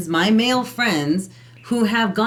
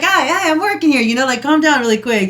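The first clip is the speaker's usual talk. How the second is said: mock annoyed; 7 semitones higher, 4 dB louder than usual. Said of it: "she's like".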